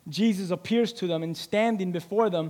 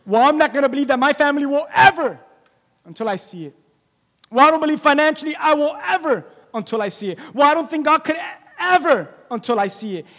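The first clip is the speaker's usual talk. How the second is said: louder, quick skeptical voice; preacher voicing listeners' doubts, skeptical; mock doubter; 6 semitones higher, 4 dB louder, faster than usual. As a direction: mimic the skeptic's excuses. Congregation's doubting voice.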